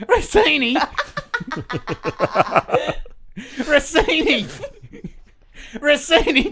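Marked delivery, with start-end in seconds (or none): none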